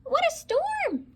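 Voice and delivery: very high, chirpy bird voice; twittering wren. Acted. Wren twitters about the storm, very high and chirpy. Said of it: "twitters wren".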